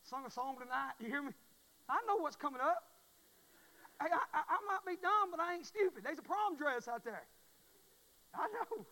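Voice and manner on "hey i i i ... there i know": high, loud knowing cry; excited shout. Real, not acted. Preacher telling church about daughter, loud and high. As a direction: shout it to the crowd.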